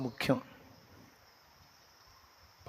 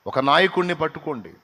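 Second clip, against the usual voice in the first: louder, higher urging from the pulpit; forceful exhortation; 4 semitones higher, 6 dB louder, faster than usual. Acted. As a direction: press the church to settle down. (గట్టిగా హెచ్చరించే ప్రసంగం)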